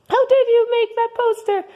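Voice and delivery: high Martha voice. Acted. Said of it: "I am Martha".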